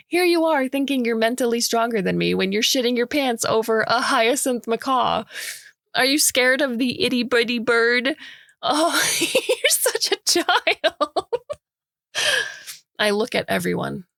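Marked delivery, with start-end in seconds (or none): 0.1-11.3 put-on higher, louder baby-talk teasing; mocking taunt. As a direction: tease him like a scared toddler. Mocking Gray as cowardly child.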